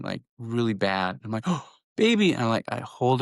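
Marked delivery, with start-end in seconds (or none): 1.7-2.3 put-on alarmed baby. Mom reacting to daughter sobbing.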